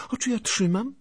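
put-on very high girlish retort; pert girl. A narrator's reading. Anusia blocks his way while denying it, pert.

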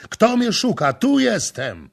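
high, loud answer; bold call. A narrator's reading. Prince calling out to the intruder, bold. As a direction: announce yourself boldly.

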